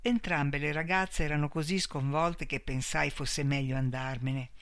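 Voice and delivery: usual speaking voice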